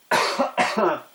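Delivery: sudden harsh cough; coughing fit. Real, not acted. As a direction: cough hard, choking. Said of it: "Sorry, I'm choking on volcanic ash".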